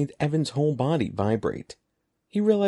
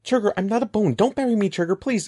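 frantic pleading; 10 semitones higher than usual. A narrator's reading. Evan begging Trigger not to bury him, frantic high plea. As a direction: plead desperately, near screaming.

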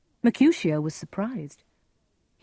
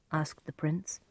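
ordinary delivery

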